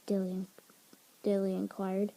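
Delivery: ordinary delivery, nothing marked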